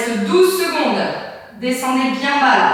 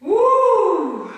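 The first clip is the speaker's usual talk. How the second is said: excited whoop; 8 semitones higher, 5 dB louder than usual. Real, not acted. Exertion cheer.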